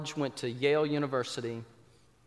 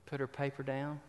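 ordinary talk, nothing marked

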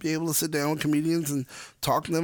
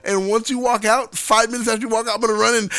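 higher, louder indignant rant; worked-up rant; 7 semitones higher, 6 dB louder than usual. Retold or imitated. [name] acting out confronting the store, loud laughing rant. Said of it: "he said".

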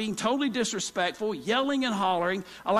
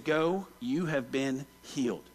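gentle blessing; 7 semitones lower, 4 dB quieter, slower than usual. Retold or imitated. Jesus blessing her.

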